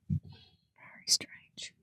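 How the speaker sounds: barely audible slow whisper; whispered aside. Real, not acted. Player reacting under their breath, whispered. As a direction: murmur it to yourself without voice.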